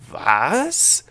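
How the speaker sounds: startled, indignant questioning; irritated astonishment. A narrator's reading. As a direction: react loudly, baffled and put out.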